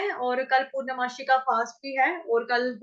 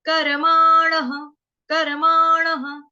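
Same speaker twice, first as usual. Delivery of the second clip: कर्माणः said slowly and much louder, each syllable clear; loud slow demo; 5 semitones higher, 8 dB louder, much slower than usual. Shown as a demonstration. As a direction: call out कर्माणः slowly for students to copy. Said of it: "teaching pronunciation of कर्माणः". (कर्माणः ज़ोर से, धीरे धीरे)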